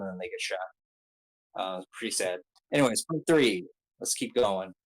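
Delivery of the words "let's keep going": ordinary talk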